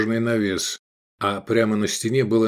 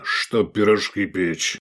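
slow, menacing growl; slower than usual. A narrator's reading. Insulted cook to a barbarian, growled. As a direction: growl it out in offence. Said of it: "прорычал он".

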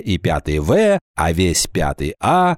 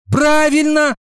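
very high, eager agreement; chiming in; 4 dB louder than usual. A narrator's reading. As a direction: jump in to back a classmate. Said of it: "подхватила Кадушкина". (подхватить звонко)

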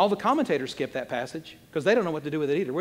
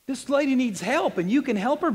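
indignant challenge; 8 semitones higher, 5 dB louder than usual. Acted. Preacher pleading the woman's case, loud indignant protest. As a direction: challenge him loudly, drawing out the words.

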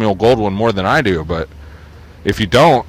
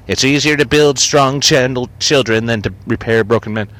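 mock bumper-sticker lady; 3 semitones higher than usual. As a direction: mimic the woman with the sticker. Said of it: "a bitch would put on her fucking Prius".

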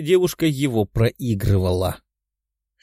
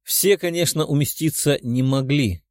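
ordinary delivery, nothing marked

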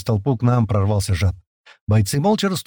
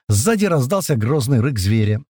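ordinary delivery, nothing marked

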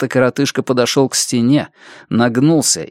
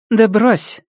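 much higher, dismissive female character; Andrea's dismissal; 10 semitones higher than usual. Acted. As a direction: brush him off, pitched up. Andrea brushes off the man's lament. (пренебрежительно, высоким голосом)

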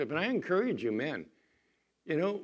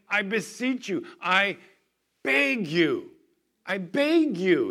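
higher and louder, begging, slowing at the end; yelling plea; 7 semitones higher, 6 dB louder, slower than usual. Acted. Preacher acting Paul pleading with the brethren, loud begging. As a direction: voice Paul begging the church at full volume. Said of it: "Paul is yelling at them".

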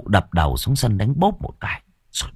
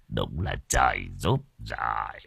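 much lower, much softer smug murmur; sly satisfaction; 11 semitones lower, 10 dB quieter than usual. Acted. Master agreeing with his wife's plan, low soft smug murmur. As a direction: savor it quietly, pleased.

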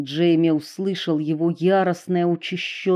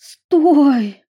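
high loud cry to stop; urgent shout; 8 semitones higher, 8 dB louder than usual. A narrator's reading. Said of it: "схватила его за руку Эдисон".

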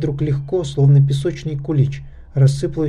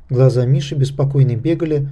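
plain reading voice

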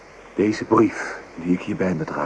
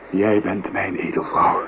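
usual speaking voice

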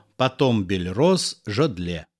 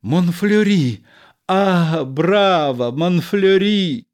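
loud, raised cheering calls; applauding cheer; 6 semitones higher, 4 dB louder, slower than usual. Acted. Audience applauding the actor, loud excited cheering. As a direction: cheer him on like a theatre crowd. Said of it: "аплодируя".

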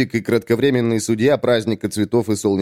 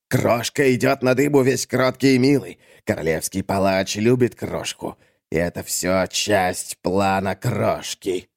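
sly brutish schemer. Acted. (хитро, всё тише и медленнее)

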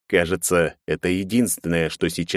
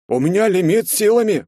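high, loud whiny twang; whining twang; 13 semitones higher, 5 dB louder than usual. A narrator's reading. Fat henchman protests to his boss about his limit, whining twang. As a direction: whine it out in protest, high-pitched. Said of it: "прогундосил тот".

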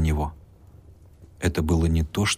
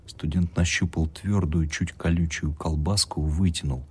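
usual speaking voice

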